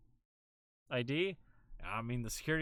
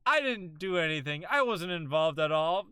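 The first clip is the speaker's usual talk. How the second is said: sarcastic; 7 semitones higher, 5 dB louder than usual. Retold or imitated. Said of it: "Ah, yes".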